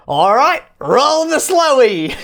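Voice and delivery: high, stretched mock Aussie call; imitating Australian hosts. Podcaster quoting a channel's funny slow-motion catchphrase, high drawn-out Australian accent. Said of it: "they just say it in the funniest".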